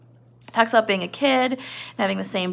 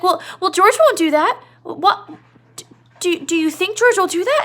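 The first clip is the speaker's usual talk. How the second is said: high, stammering frightened voice; scared Lenny; 9 semitones higher, 5 dB louder than usual. Retold or imitated. Lenny afraid George won't return, high and stammering. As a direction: stumble out the words, high and scared. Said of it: "Lenny's like".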